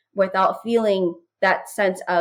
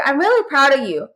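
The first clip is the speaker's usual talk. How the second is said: lightly imitated child, higher pitch; Avery; 6 semitones higher, 4 dB louder than usual. Daughter checking on mom and the pillow, higher child voice. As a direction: play a concerned kid, pitched up and a bit louder. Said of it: "she'd be like".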